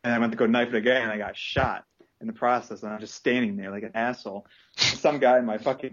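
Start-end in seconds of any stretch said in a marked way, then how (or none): none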